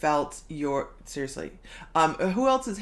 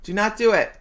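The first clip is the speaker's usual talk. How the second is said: much louder, calling out in mock alarm; urgent warning; 4 semitones higher, 8 dB louder than usual. Host jokingly calling to Jude to stop, loud. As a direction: shout a warning across the room.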